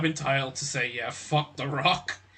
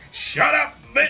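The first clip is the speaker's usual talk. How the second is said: much higher, louder mock yell; Rock's shout; 13 semitones higher, 6 dB louder, slower than usual. Quoting the Rock's clip to a friend, high mock yell. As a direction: bark it like the Rock cutting down a rival. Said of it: "that one clip of The Rock".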